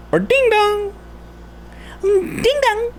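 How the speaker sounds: very high sung doorbell chime, ding dong. Retold or imitated.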